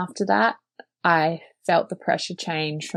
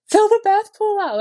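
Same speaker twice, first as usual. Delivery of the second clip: much higher, louder retold scream; screamed order; 6 dB louder than usual. Laboring wife screaming at her sleeping husband, much higher and louder. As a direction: yell it at him in the thick of labor. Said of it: "I just screamed at him".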